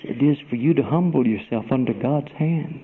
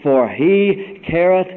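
high, loud preacher's shout building up; preaching at full force; 9 semitones higher, 7 dB louder, slower than usual. Acted. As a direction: belt it out like a revival preacher. Preacher shouting the scripture theme.

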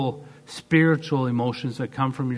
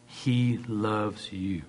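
tender assurance; 3 dB quieter, much slower than usual. Real, not acted. Tender assurance of God's love.

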